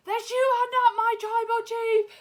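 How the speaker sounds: high, loud outburst imitating a wrestler; acted high-pitched defiant yell; defiant shout. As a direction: yell it out as a retold defiant declaration. Retold defiant outburst on the mic.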